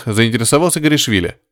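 usual speaking voice

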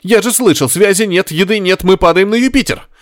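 high, rushed panic; frightened passenger losing his nerve; panicky outburst. A narrator's reading. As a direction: voice pushed up high with alarm. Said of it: "взорвался Горишвили".

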